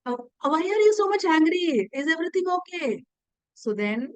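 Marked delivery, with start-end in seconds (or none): 0.0-3.0 high, concerned voice asking what's wrong; a worried wife asks her angry husband what's wrong; worried queen. Retold or imitated. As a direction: ask anxiously like a caring wife. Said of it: "she's like".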